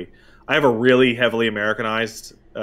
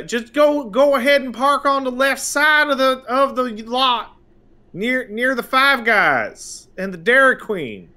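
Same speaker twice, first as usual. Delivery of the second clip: friendly clerk; 11 semitones higher than usual. Retold or imitated. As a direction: give directions like a chirpy prairie clerk. Staff giving parking directions.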